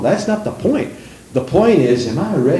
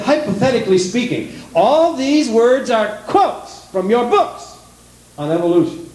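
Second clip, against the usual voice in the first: scornful preaching; 10 semitones higher than usual. Acted. Preaching climax, mocking doubt words.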